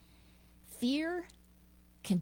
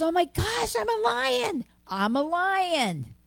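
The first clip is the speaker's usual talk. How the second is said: loud and much higher, joyful cry; thrilled discovery; 10 semitones higher, 7 dB louder than usual. Acted. Cub discovering he is a lion, loud and high with joy. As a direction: burst out in delight, slowing at the end.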